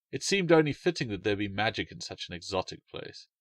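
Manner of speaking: ordinary delivery, nothing marked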